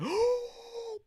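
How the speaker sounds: high, noisy sucked-in breath; hammed-up greedy inhale, pitched high; exaggerated gasp. Streamer pretending to gulp the party guests' air, exaggerated gasp.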